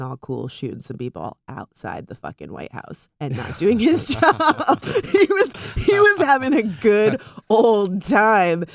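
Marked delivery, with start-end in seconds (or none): 3.6-8.6 loud, high and stretched out through laughter; laughing outburst. Real, not acted. Ranting to co-host about Bush slacking off, laughing exclamation. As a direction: break up laughing while exclaiming.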